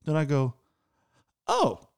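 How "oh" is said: high-pitched, loud, over-agreeable panic; hammed-up scramble to get away after rejection; flustered backpedaling. Acts flustered reaction to rejection.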